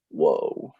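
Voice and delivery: low-pitched awestruck reaction; retold awe. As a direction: let it drop low in re-enacted amazement. Retelling her awed first sight.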